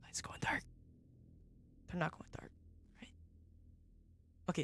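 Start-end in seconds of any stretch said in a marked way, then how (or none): 0.0-3.1 hushed, very soft whisper; nervous whisper. Real, not acted. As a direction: whisper it warily to yourself. Nervously checking the lights.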